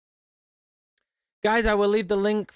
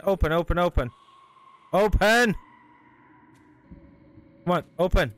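frantic pleading; 3 semitones higher, 3 dB louder than usual. Real, not acted. Door won't open, chased.

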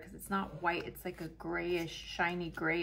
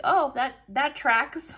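mock deadpan; 7 semitones higher, 10 dB louder, slower than usual. Strangers' deadpan reaction now.

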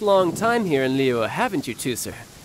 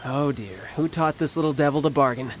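ordinary talk, nothing marked

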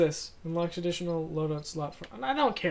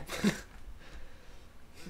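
breathy laugh; 6 dB quieter than usual. Real, not acted. Laughing at game.

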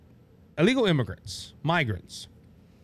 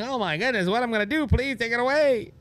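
higher, hammed-up fluster; mock panic; 9 semitones higher than usual. Person with the problem at their front door, mock panic. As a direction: fake alarm, begging someone to take it away. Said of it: "you're like".

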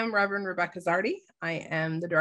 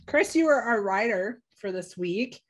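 ordinary talk

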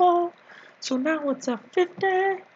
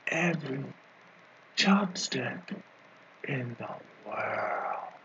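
solemn superhero proclamation; 7 semitones lower, 5 dB quieter than usual. Acted. Comedian declaring himself the comic book hero, solemn low proclamation.

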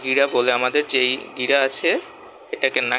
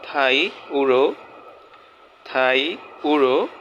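slow clear pronunciation; slower than usual. Shown as a demonstration. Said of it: "প্রনাউন্সিয়েশনগুলো একটু খেয়াল করবেন".

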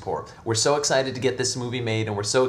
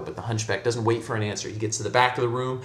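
usual speaking voice

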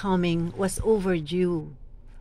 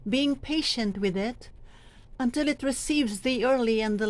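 formal scripture reading; 3 semitones higher than usual. Shown as a demonstration. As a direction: proclaim the letter with patient conviction. Demonstrates the second reading.

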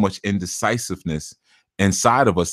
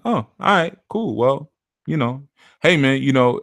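higher, breezy fake-agreeable voice; mock casual; 6 semitones higher than usual. Shown as a demonstration. Reader showing listeners his fake easy agreement. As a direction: play it easy-going and cool. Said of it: "When you hear me do that".

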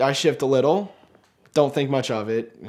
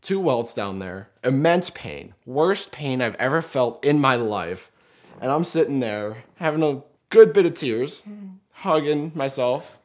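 normal talking voice

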